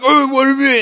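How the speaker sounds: loud, high-pitched comeback; indignant retort. Acted. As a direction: shout back as if picking a fight. Indignant reply in acted quarrel.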